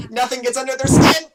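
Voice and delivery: high, loud gleeful yell; shouted punchline. Acted. Skeleton springs joke in a stressful situation, yelling gleefully. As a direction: explode into the pun.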